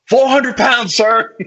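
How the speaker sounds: high, loud soldier's reply; snappy comeback. Retold or imitated. Tiny officer answers his captain, loud mock-military reply. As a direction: fire it back like a parade-ground answer.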